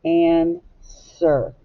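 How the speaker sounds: "Answer" in two drawn-out parts, an... ser; sounded out. Shown as a demonstration. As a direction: stretch and separate "Answer" as an, ser. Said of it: "you hear the R controlled sound".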